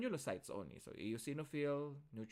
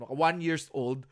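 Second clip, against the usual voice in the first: loud slip; 11 dB louder than usual. Real, not acted. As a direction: blurt it out loud. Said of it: "My God, sir".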